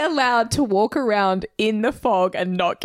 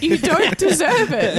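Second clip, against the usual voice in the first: laughing; 6 semitones higher than usual. Real, not acted. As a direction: say it again laughing. Repeated with laugh.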